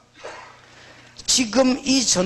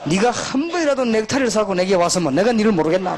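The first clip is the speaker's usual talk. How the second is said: usual speaking voice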